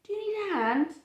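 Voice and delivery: high, friendly offer; kind helper. Acted. Bean helping a fallen friend, high friendly offer. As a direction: offer the hand brightly.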